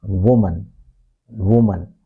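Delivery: "woman" as WUU-mun, falling; stressed first syllable, reduced second in "woman". Shown as a demonstration. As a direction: lean on the WO of "woman". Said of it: "stress is on the first syllable".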